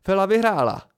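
a loud, high, delighted shout; exclaiming loudly. A narrator's reading. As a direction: announce the winner at full voice. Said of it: "vykřikl a rozhodil rukama".

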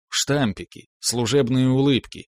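usual speaking voice

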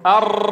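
أر said with the tongue tip trilling several times; trilled r. Shown as a demonstration. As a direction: roll the r in أر fully. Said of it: "قل معي".

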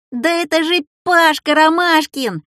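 put-on high, girlish shouted guess; excited call-out. A narrator's reading. Classmate Людка guessing who the essay describes, excited call-out. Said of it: "выкрикнула Людка Пустякова".

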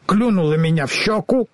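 ordinary delivery, nothing marked

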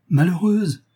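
high, falling cry of reproach; outraged exclamation. A narrator's reading. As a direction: exclaim in shocked anger at your wife. Enraged husband hears wife's confession.

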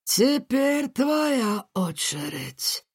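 high, slow gloating cry; drawn-out villain gloat. Acted. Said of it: "воскликнул он". (злорадный возглас злодея)

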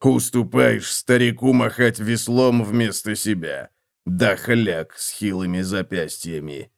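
медленно, ворчливо, презрительно; фыркни с брезгливым презрением; с отвращением. A narrator's reading. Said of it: "с отвращением фыркнул". (Готрек презрительно ворчит на эльфа, медленно и брезгливо)

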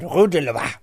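quick, exaggerated French echo; mock French accent. Claiming it was said right, mock French accent. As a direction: throw the French back at them.

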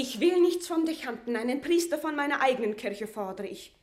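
laut und hoch, empört; heftiger Einspruch. Acted. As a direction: ihm scharf ins Wort fallen. Maria unterbricht Paulet heftig. (Maria fällt Paulet laut und zornig ins Wort)

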